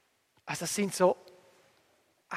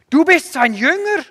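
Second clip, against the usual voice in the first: high, loud, drawn-out accusation; angry shout; 8 semitones higher, 11 dB louder than usual. A narrator's reading. Furious opponents turn on the healed blind man, shouting. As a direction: hurl it at him, loud and high. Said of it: "schrien sie ihn an".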